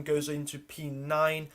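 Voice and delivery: usual speaking voice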